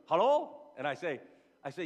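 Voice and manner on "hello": caller answering. Retold or imitated. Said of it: "somebody goes".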